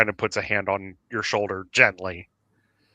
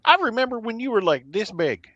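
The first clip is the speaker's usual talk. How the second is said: much higher, breathless amazement; awed disbelief; 11 semitones higher than usual. Acted. Cletus realizing Velkhana has grown up, awed high voice. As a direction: gasp it out like seeing a grown-up kid.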